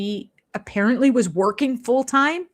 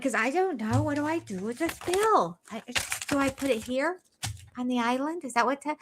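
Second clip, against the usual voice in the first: a lightly voiced, softer ditzy Robin; mock clueless wife; 6 semitones higher, 6 dB quieter than usual. Mocking Robin handing bills to Cody, a soft ditzy imitation. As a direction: play her as lost and helpless with bills. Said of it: "Robin just like".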